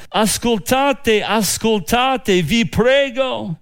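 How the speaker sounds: high, slow pleading call, not shouted; mild crying out. Acted. Wise woman calling from the besieged city, mild high-pitched cry. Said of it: "gridò dalla città".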